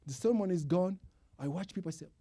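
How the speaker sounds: ordinary delivery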